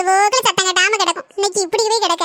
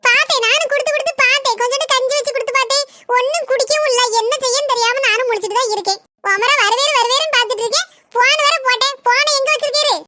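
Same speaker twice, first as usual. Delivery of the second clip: higher-voiced worried village wife scolding her husband; 5 semitones higher than usual. Village wife worrying over the sick cow and scolding her husband. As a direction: play the fretting wife, a little higher.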